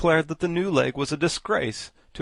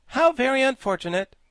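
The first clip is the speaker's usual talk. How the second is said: high, loud dismayed exclamation; high loud dismayed cry; 13 semitones higher, 7 dB louder than usual. A narrator's reading. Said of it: "cried the Woggle-Bug".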